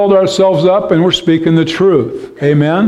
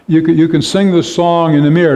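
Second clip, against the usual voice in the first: ordinary delivery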